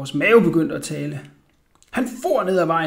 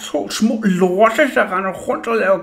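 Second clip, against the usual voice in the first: roaring; 3 semitones higher, 6 dB louder than usual. A narrator's reading. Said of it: "brølede han".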